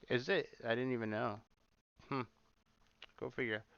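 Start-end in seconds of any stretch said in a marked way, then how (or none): none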